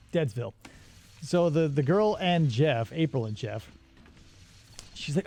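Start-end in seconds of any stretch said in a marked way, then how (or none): none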